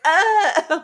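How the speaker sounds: high, loud mock yelp of pain; mock pained cry. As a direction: yelp as if struck. Imitated cry of a struck character.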